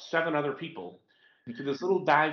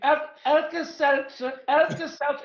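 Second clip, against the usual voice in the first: much higher, loud and slow put-on voice; hammed-up slow foreign voice; mock Danish voice; 12 semitones higher, 4 dB louder, slower than usual. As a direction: imitate the husband's silly accent. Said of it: "I was going".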